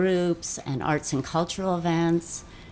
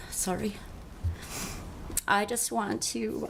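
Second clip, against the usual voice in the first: softer, voice catching on the words; fighting back tears, barely voiced; real choked whisper; 3 semitones higher, 7 dB quieter than usual. Real, not acted. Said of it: "I'm gonna get emotional".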